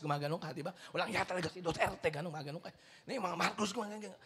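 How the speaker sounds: normal talking voice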